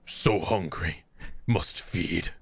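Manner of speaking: low, hungry monster rasp; starving beast begging to feed; hoarse starving cold whisper. Pretend. Monster voice continues.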